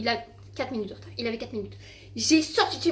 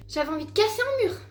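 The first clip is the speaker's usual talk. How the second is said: angry outburst; 8 semitones higher than usual. Real, not acted. Furious at brother's prank.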